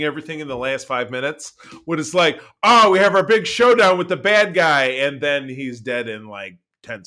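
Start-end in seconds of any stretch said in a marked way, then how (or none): 2.6-4.8 loud, higher mock-dramatic announcement; mock excitement. Host mocking the rushed ending, mock excitement. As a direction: hype up the showdown, tongue in cheek.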